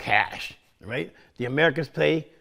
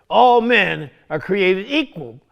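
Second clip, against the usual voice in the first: slow and louder, each word weighed; "All men are created equal" spelled out slowly with weight; solemn quotation; 2 semitones higher, 5 dB louder, slower than usual. Retold or imitated. Teacher quotes the Declaration to the class, slow and emphatic. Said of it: "The Declaration of Independence says".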